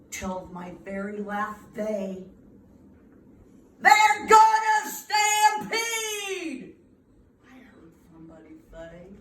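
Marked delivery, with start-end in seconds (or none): 3.8-6.8 high, loud, slow alarmed cry; panicked shout. Acted. Somebody warning the crowd of the stampede, loud panicked cry. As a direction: yell the warning in fright.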